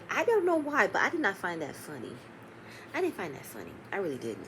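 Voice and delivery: real hushed disapproval; disapproving aside. Real, not acted. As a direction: say it quietly, not amused.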